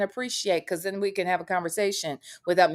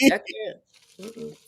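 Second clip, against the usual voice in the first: normal talking voice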